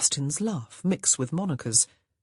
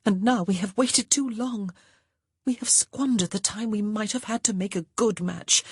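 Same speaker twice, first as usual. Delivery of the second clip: higher-pitched, mildly angry complaint; 6 semitones higher than usual. A narrator's reading. Grace blaming her sister for their uncertain future, mildly angry. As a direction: let restrained anger build toward the last phrase. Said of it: "Grace said angrily".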